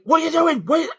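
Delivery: high, loud protest; retold outraged yell. Driver challenging the woman cutting in, re-enacted yell. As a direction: re-enact shouting in disbelief.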